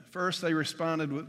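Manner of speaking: ordinary delivery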